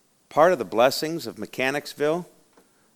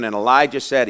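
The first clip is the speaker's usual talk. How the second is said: ordinary talk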